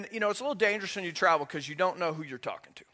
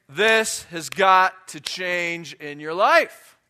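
loud, very slow, weighty demand; stern rebuke; 8 dB louder, much slower than usual. Acted. Preacher confronting some of his listeners, stern rebuke. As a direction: land each word as a firm reproof. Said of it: "look at some of you and say".

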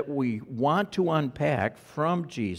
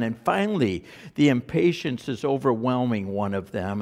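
normal talking voice

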